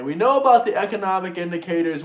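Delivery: normal talking voice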